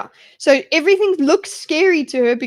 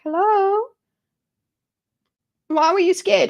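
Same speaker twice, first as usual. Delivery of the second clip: much higher, cute little-animal voice; sweet forest animals; 7 semitones higher than usual. Retold or imitated. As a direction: chirp it like a tiny friendly critter. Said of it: "the animals are all like".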